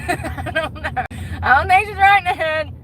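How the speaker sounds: high-pitched pretend concern; mock concerned helper. Pretend telling ER staff about customer, high mock concern. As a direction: play it high, like a bewildered good Samaritan.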